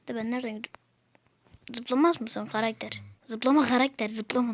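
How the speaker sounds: ordinary talk, nothing marked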